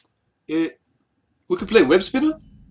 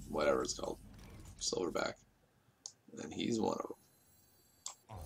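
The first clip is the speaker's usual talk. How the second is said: whispering; 5 semitones lower, 15 dB quieter than usual. Real, not acted. Muttered aside while playing.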